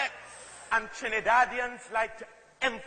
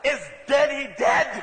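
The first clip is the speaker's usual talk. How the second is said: louder, punchy Trinidadian Creole "Is dead, he dead?"; emphatic Trinidadian; 7 dB louder than usual. Retold or imitated. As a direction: hit it hard the Trinidadian way. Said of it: "A Trinidadian will never say".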